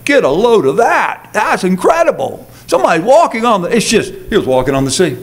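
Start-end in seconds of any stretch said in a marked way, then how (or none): 0.1-3.8 mock astonished onlooker, higher pitch; hammed-up gawking excitement; amazed exclaiming. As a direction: marvel as if you can't believe the sight. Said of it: "they don't park it and say".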